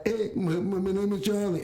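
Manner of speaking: high, stammering, lisped mimicry; mocking imitation. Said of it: "they start clowning me".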